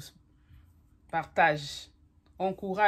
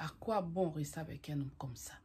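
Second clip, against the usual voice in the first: whispered; 2 semitones lower, 8 dB quieter than usual. Real, not acted. Confiding rhetorical question to viewer.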